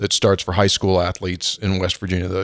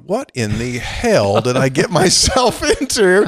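slow, pitched-up disbelief with a laugh; mock bewildered newcomer; 5 semitones higher, slower than usual. Host voices the new coach's doubts, slow and bewildered. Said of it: "you gotta be going".

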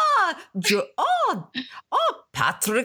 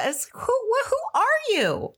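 much higher, incredulous question; high-pitched mock dismay; mock disbelief; 14 semitones higher than usual. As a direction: squeal it in exaggerated bafflement. Mock dismay at mom's accent.

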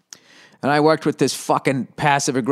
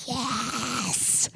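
whispered, higher cheer held in; silent scream; 11 semitones higher, 11 dB quieter than usual. Retold or imitated. Young comic celebrating alone in his apartment with a silent scream. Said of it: "screaming in silence".

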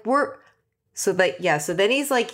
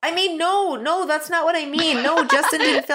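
higher-pitched flustered backtracking; Genevieve; 6 semitones higher than usual. Retold or imitated. Host imitates a contestant correcting her own blunder, high flustered protest. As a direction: mimic someone hastily taking it back. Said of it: "And she's like".

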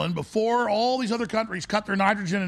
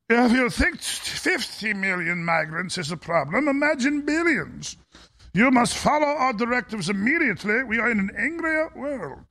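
German accent impression; 4 semitones higher than usual. Host mocking a globalist leader in a German Strangelove accent. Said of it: "When I try a German accent anymore, like a Doctor Strangelove".

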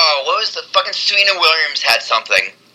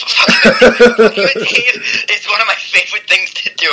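higher, slower words broken up by laughter; cracking up while confessing to teasing a friend; laughing; 6 semitones higher, 3 dB louder, slower than usual. Real, not acted. Laughing about teasing his friend.

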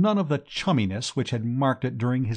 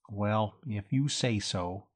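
doubtful; 3 semitones lower, 7 dB quieter, slower than usual. Acted. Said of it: "said Gussie doubtfully".

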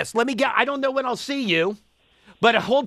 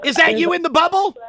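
excited; 7 semitones higher, 6 dB louder than usual. Real, not acted. Host spots diver on cam.

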